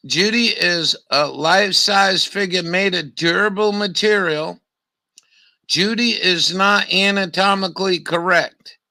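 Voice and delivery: loud, declaimed sales copy; reading an advert aloud. Acted. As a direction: read the product blurb out loud to the room. Reads product blurb aloud.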